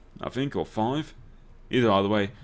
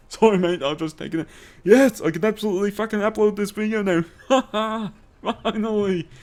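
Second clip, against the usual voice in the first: high excited mock apology then cheer; gleeful gloating; 12 semitones higher, 5 dB louder than usual. Real, not acted. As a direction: gloat over the other players, laughing. Game win, gloating.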